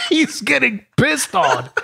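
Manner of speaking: high, slow words through laughter; laughing. Real, not acted. Telling his friend the punchline, laughing high.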